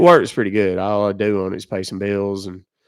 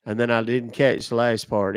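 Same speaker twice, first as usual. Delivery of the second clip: normal talking voice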